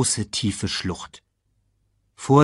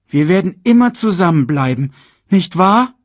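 put-on high, loud child voice, rising at the end; Simba; 11 semitones higher, 9 dB louder than usual. A narrator's reading. Cub asking his father for reassurance, high. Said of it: "sagte Simba".

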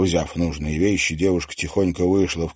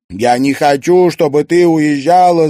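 louder and higher, insistent; firm declaration; 9 semitones higher, 9 dB louder than usual. A narrator's reading. Daughter refusing to let her mother leave, firm loud protest. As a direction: state it firmly. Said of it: "заявила Луиза".

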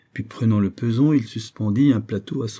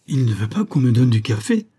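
loud, raised cry of protest; indignant outcry, higher and louder; exclaiming; 4 semitones higher, 6 dB louder than usual. A narrator's reading. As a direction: exclaim it as a dismayed question. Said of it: "s'écria Isaac".

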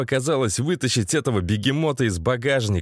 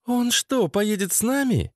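high-pitched, incredulous female question; questioning woman; 10 semitones higher than usual. Acted. Lena questions Sasha about his friend, high doubting voice. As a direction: ask it doubtfully in a raised pitch.

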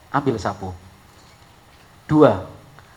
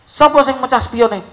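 angry father; 11 semitones higher, 7 dB louder than usual. Retold or imitated. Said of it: "Bapaknya tanya".

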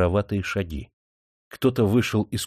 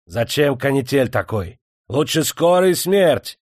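Tatar bandit jeering, higher and louder; mocking shout; 5 semitones higher, 5 dB louder than usual. A narrator's reading. Bandit jeers at the officer, mocking shout. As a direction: taunt him, slowly, with a yell. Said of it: "насмешливо выкрикнул татарин".

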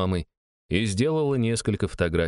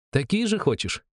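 put-on higher, friendly voice to a child; smiling young woman voice; 10 semitones higher than usual. A narrator's reading. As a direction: speak kindly down to a little girl. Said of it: "улыбнулась Шама".

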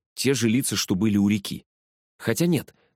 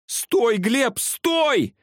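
put-on high panicked girl's shriek; urgent, high-pitched squeal; much slower than usual. A narrator's reading. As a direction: cry out shrilly for him to stop. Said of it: "завизжала девчонка".

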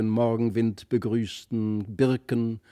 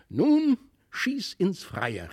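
higher, drawn-out command; called command; 7 semitones higher, slower than usual. A narrator's reading. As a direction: order it slowly in a raised pitch. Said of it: "rief er".

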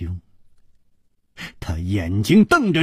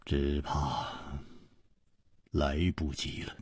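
with a sigh; 10 semitones lower, 15 dB quieter than usual. A narrator's reading. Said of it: "楚留香叹了一口气".

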